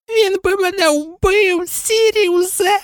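slow, very high whine; moaning plea. A narrator's reading. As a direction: drag out a squeaky, fearful plea. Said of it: "простогнав Петтігру". (протяжний тоненький жалібний стогін)

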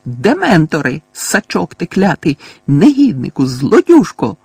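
put-on old neighbour cursing a thief; angry scolding. A narrator's reading. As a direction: pile the curses on him, rising in fury. Fig berates Mundungus.

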